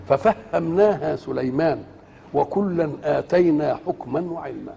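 slow, soft chanted recitation; Quran recitation. Acted. Preacher recites the verse to listeners in slow chant. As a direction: recite the verse slowly and softly.

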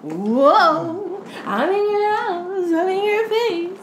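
high, loud, drawn-out taunt; hammed-up bee-monster voice, slowly stretched; demon bee. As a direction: buzz in as a taunting little demon. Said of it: "The demon bee is like".

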